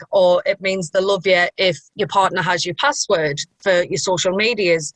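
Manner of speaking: ordinary delivery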